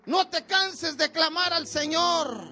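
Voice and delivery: ordinary talk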